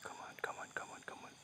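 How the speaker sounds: very soft, voiceless whispered urging; urgent whisper. Real, not acted. Ghost hunter urging as the spirit comes down, whispered. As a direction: whisper it repeatedly under your breath. Urging himself beside the apparition.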